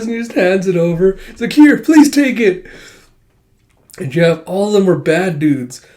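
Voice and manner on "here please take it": high, loud, slow mock plea; panicked pleading. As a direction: plead as if giving in. Cowed character hands it over.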